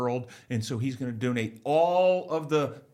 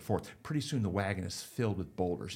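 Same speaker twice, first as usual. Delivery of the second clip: ordinary talk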